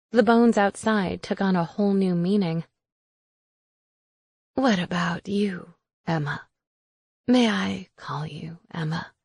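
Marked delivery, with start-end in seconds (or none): 4.5-9.1 whispering witch. Acted. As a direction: whisper it like a creepy old hag. Witch Raisa speaks.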